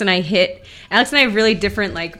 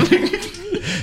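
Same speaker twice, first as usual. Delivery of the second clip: high giggly hum; laughing refusal; 12 semitones higher than usual. Real, not acted.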